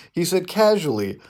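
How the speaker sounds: ordinary talk, nothing marked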